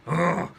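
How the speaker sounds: loud gruff possessed grunt. Acted. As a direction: grunt loudly like a possessed girl. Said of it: "She has this gruff man's voice".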